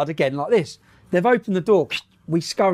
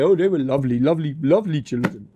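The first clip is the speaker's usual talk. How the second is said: mock doting parent; 2 semitones lower than usual. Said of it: "their mum and dads would say".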